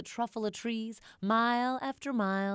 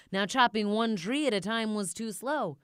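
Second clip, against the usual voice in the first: ordinary delivery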